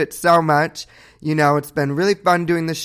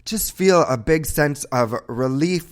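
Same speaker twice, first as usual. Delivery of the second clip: usual speaking voice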